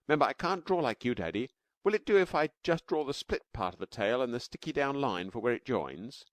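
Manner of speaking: put-on high and fast child voice; little girl Taffy, rushing. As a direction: tumble the words out eagerly.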